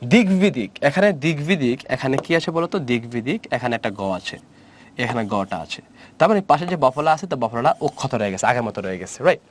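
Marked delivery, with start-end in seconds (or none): none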